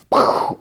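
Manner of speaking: mouthed gunshot noise; short puffed gunshot effect; imitated gunshot. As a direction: blow out a short burst like a gun firing. Imitating the gunshot as she shoots.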